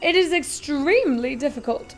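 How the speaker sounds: a giddy chocolatier showing off; Willy Wonka, grand and eager; eccentric Wonka. Acted. Wonka explaining.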